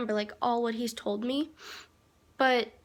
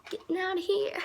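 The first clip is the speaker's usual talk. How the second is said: inner glee; 6 semitones higher than usual. Retold or imitated. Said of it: "but I'm like".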